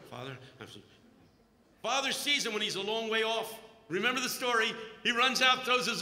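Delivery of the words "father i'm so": very soft, low trailing plea; timid muttering. Retold or imitated. Prodigal son rehearsing on the walk home, very soft. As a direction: let it fade out under the breath.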